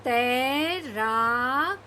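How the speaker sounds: तैराक stretched out very slowly, rising at the end; very slow reading. Shown as a demonstration. Teacher asking kids to read slowly, drawing the word out. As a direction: draw out तैराक syllable by syllable. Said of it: "धीरे धीरे पढ़ने की कोशिश करिए".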